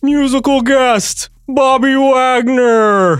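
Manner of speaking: high, loud, drawn-out TV announcer call; slow, soaring late-night show introduction; SNL announcer voice. Acted. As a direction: announce the musical guest with stretched-out showbiz fanfare. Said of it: "the S N L voice".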